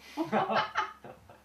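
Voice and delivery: high, loud burst of laughter; hearty laugh. Real, not acted. 스스로 겪은 일 말하며 웃음. (크게 터뜨리는 웃음)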